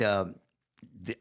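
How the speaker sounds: normal talking voice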